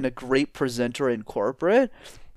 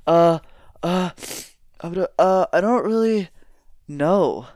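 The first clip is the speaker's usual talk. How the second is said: hesitant, higher and halting; stressed, worried; 4 semitones higher than usual. Shown as a demonstration. Leader caught out in the boardroom, nervous and halting. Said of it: "notice how I look stressed, I look worried".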